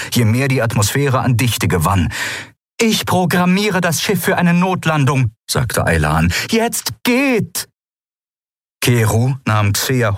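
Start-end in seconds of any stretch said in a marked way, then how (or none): 2.8-5.3 high, pressing answer; urgent. A narrator's reading. Aylan answers Kai aboard the failing ship, urgent. 6.5-7.7 high, pressing order; urgent command. A narrator's reading. Aylan sends the crew off the ship, urgent command.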